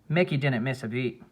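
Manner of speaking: plain reading voice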